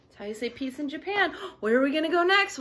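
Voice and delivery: ordinary delivery, nothing marked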